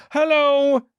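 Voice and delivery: very high, loud dopey falsetto; hammed-up clueless greeting; dumb commercial dad. Dad pops up in the ad, mock idiot falsetto.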